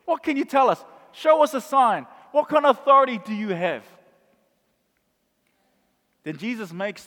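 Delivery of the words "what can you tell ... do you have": rising to an angry shout; louder and louder, demand a sign; demanding crowd. Acted. Temple crowd demanding Jesus prove his authority, shouting. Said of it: "and they say".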